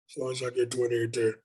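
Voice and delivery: lightly done breathy, bassy Shaq voice; a low breathy big-man voice, said with ease; Shaq impression. Shown as a demonstration. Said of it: "a Shaq, Shaq is real breathy and bassy".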